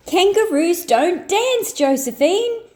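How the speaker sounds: yelling. A narrator's reading. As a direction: call it out at your sister. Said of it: "yelled Joey".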